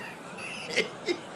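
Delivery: airless, high-pitched laugh; high breathy laugh. Real, not acted. Streamer cracks up at the walkout, high airless laugh. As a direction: burst into a silent high giggle.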